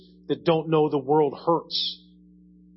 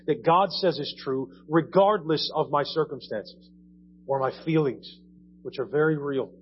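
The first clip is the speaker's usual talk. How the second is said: ordinary talk, nothing marked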